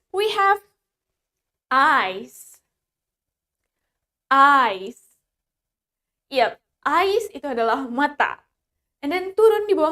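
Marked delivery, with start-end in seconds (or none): none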